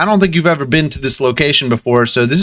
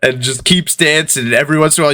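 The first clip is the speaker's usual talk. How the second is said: usual speaking voice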